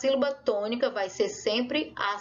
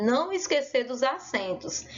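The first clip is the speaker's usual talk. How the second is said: normal talking voice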